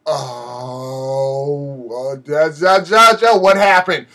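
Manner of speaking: mock panic, stammering up to a loud question; acted stunned stammer. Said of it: "my whole internet was frozen. It was just like".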